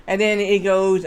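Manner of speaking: usual speaking voice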